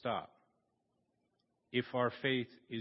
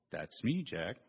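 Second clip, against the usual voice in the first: voice of God; 6 semitones lower than usual. Acted. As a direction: speak calmly from above, much lower. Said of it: "I'm God".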